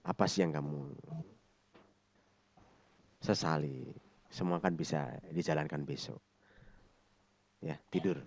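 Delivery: soft, low reassurance with long pauses; caring, soothing. Shown as a demonstration. Counselor soothing an angry child at night, soft and caring. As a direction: gently soothe them toward sleep. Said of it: "boleh dengan care, dielus-elus".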